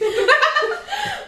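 a long high-pitched laugh. Real, not acted. Risa fuerte. (carcajada aguda)

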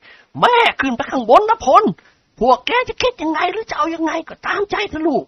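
an anxious mother talking to her son; frightened old mother. A narrator's reading. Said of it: "ด้วยความหวาดกลัวปีศาจ". (คุณหญิงวาดพูดกับลูกชายด้วยความกลัวผี)

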